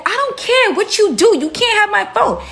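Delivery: high-pitched, stubborn refusal; defiant imitation. Girl refusing to hand over her phone, high and defiant.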